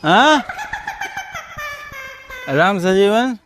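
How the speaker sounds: ordinary delivery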